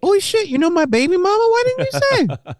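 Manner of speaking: high, fast, mock-startled voice; mock surprised cop. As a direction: squeak it out like a suddenly scared cop. Mocking cop's sudden change.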